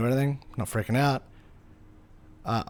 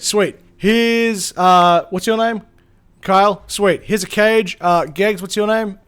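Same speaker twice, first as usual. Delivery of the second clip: louder, lightly voiced brisk woman handing out gear; a hint of a bossy rescuer, hurried and louder; brisk organizer; 7 semitones higher, 8 dB louder, slower than usual. Rescuer handing out gear to the boys, brisk and louder. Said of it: "And she goes".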